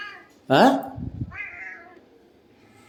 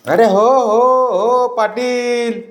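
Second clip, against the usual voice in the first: high pet talk; 11 semitones higher, slower than usual. Real, not acted. Man greeting his excited dogs at the door, high sing-song pet talk. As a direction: gush over the excited dog in a high voice.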